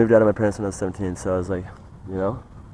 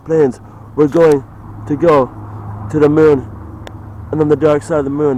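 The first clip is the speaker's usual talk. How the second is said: loud, higher mock rallying speech; commander rallying troops; 4 semitones higher, 6 dB louder than usual. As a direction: bellow orders to a gathered crowd. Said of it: "let's say he was like".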